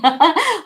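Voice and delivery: high, loud laugh bursting out; burst of laughter. Real, not acted. Laughing at Yati off camera, real laughter. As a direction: laugh out at the friend's remark.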